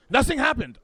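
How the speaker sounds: put-on high, loud German-accented denial; German tour guide. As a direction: snap back in a mock German accent. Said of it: "they're over in Germany".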